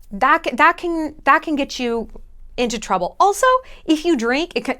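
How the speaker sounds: normal talking voice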